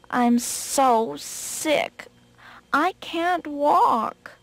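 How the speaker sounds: very slow, feigned sick moan; drawn-out, pitiful put-on moan. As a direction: moan it out as a rabbit faking illness. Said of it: "he opened the door and moaned".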